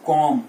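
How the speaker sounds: "comb" ending on M, kawm; silent B. Shown as a demonstration. Teacher correcting learners, comb said with silent B. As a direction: say comb without the B. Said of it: "B is silent".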